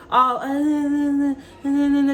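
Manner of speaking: high, timid, hesitant uh-uh-uh, lightly hammed; mock meek stammer. Shown as a demonstration. Mocking the sweet gentle reader she refuses to be, a lightly hammed timid stammer. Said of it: "I can't be all sweet and nice to you".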